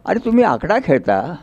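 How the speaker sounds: ordinary talk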